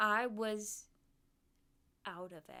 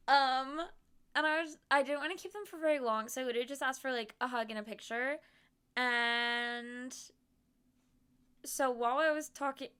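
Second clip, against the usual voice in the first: usual speaking voice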